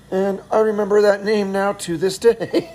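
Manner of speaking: ordinary talk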